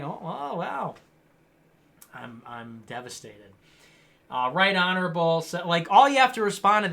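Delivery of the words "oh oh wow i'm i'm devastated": mock devastation. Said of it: "sarcastic response to troll".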